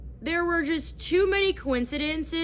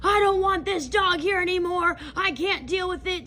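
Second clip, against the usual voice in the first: imitated flipping out; 4 semitones higher, 4 dB louder than usual. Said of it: "flipped out and was like".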